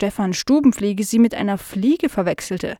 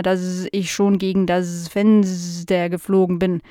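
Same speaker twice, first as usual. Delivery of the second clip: forgetful housefly. Acted. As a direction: confess vaguely, drawing the words out. Fly character speaking.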